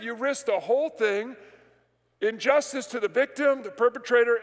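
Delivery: normal talking voice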